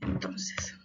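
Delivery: usual speaking voice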